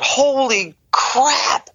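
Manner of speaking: sudden high-pitched yelp of alarm; retold scream. Lung test attendant shrieking at the result. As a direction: shriek it like you've just been startled. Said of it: "and he screamed out".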